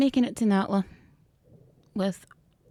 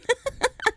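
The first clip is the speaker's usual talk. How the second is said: short, high giggle; real giggle pitched way up; relieved laughter. Real, not acted. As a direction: let out a quick high laugh. Relieved laughter after survival.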